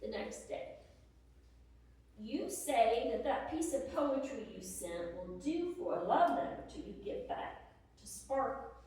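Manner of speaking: usual speaking voice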